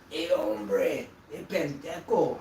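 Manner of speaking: loud mock Mexican accent. Showing off an accent for Mexico, loud Mexican accent. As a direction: call out in a put-on Mexican accent. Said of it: "Mexico, I can totally do".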